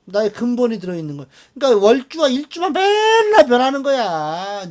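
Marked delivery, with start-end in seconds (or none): 2.7-4.7 맨날 stretched long, high and loud; drawn-out emphasis. Real, not acted. Teacher stressing to students that the pillars always change, emphatic. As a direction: lean hard and long on 맨날.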